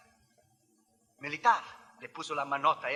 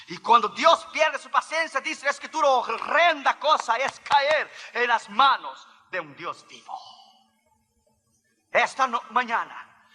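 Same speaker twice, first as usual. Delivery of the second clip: raised, higher-pitched scripture warning; forceful preaching; 7 semitones higher, 6 dB louder than usual. Real, not acted. Preacher warning that God loses patience, forceful preaching. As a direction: drive the warning home with a high, insistent voice.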